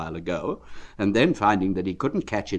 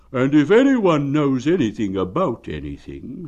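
louder, thinking-aloud bear voice; bear to himself; 5 semitones higher, 6 dB louder than usual. Acted. Pooh talking to himself on his way to Owl. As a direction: muse it out to yourself. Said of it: "said Bear to himself".

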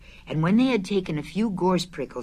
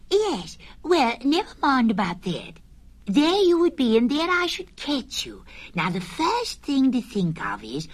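much higher, brisk little Piglet; 7 semitones higher than usual. Acted. Piglet interrupting Pooh's honey dream, high and brisk. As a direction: cut in and hurry him along.

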